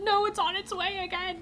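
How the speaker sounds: high-pitched panicked squeal; freaking out. Retold or imitated. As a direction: squeal a warning in re-enacted panic. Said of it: "I was freaking out".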